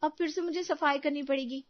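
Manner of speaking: high-pitched whine; grumble, then appeal to father-in-law; complaining daughter-in-law. Acted.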